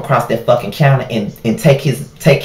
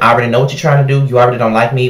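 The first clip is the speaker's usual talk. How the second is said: ordinary talk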